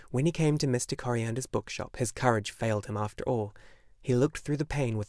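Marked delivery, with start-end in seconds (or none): none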